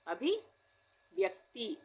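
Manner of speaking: अभिव्यक्ति drawn out, half व clear; slow dictation. Shown as a demonstration. Teacher dictating the word slowly. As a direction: stretch अभिव्यक्ति syllable by syllable. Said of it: "आधा व".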